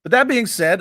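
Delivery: loud hype catchphrase; announcer intro. Acted. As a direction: punch it like an announcer.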